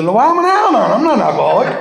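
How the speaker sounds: high-pitched, half-laughing protest; indignant protest. Retold or imitated. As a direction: jump way up in pitch, insisting you're not the alcoholic. Protesting to strict counselor.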